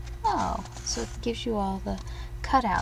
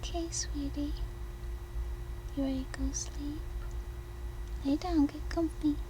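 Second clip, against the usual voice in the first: pillow talk; 6 semitones higher, 6 dB quieter, faster than usual. Real, not acted. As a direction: gently settle a little one to sleep. Bedtime talk to child.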